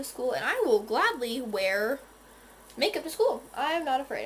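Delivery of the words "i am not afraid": ordinary talk, nothing marked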